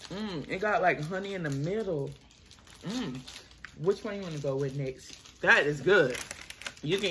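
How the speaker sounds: chewing while talking; talking between bites of muffin; mouth full. Real, not acted. Seen 3: Woman ate cornbread muffin just before; mouth working, hand to mouth.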